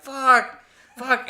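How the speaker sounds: high, loud mock cry of pain; retold screaming. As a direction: yelp it like a wimp, played for laughs. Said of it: "So I'm screaming like a little bitch".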